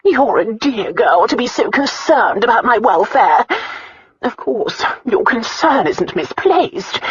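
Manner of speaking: high, loud, slowing self-pitying lament. A narrator's reading. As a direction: play the wronged aunt, dragging the ending. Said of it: "self-pity she heard in her aunt's voice".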